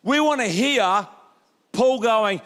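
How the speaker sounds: ordinary delivery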